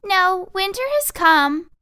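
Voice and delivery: high, loud, slow teasing squeak; fake-solemn mouse fighting back a grin; mouse hiding a smile. Teasing mouse fibbing to Tweez, high and slow. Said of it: "as he tried to hide a smile".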